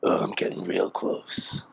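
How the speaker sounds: low breathy whisper; creepy whisper. Acted. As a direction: whisper it like something creeping closer. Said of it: "I creeped myself out there".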